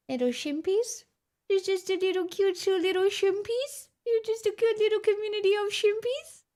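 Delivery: high cooing voice like talking to a puppy; cutesy baby talk. Acted. Cooing at chat as her shimpies.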